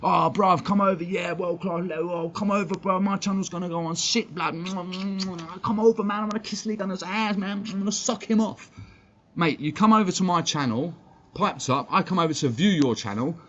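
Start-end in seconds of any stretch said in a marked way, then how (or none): 0.0-8.7 high, whiny mock of a suck-up begging to collab; mocking Aaron. Streamer mocks a rival begging to join his channel, high mock voice. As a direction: pitch it up and sneer like a desperate hanger-on. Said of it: "Aaron did come into our chat and said".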